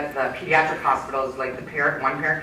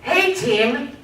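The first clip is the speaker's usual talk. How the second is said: much higher and louder, booming cheerful hello; hearty surgeon greeting; 7 semitones higher, 6 dB louder than usual. Retold or imitated. Surgeon striding into the operating room, greeting his team loudly. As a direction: sweep in and hail the whole team. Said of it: "he walks in and he says".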